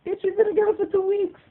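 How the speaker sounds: high-pitched mocking outrage; incredulous retort. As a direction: squeal the disbelief high.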